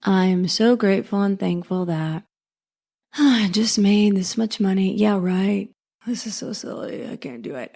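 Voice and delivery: flat, doubtful mumble sinking to a near whisper; half-hearted affirmation. Shown as a demonstration. Coach mocking a viewer who doesn't believe, sinking into a defeated near whisper. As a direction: recite it like you don't believe a word. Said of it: "if you're just sitting there going".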